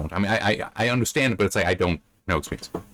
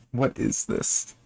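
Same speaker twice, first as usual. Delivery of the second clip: slow, breathy disbelieving whisper; whispered under the breath in disbelief; baffled whisper; 3 semitones higher, much slower than usual. Real, not acted. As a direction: whisper it, stunned by the nonsense. Baffled by the odd story.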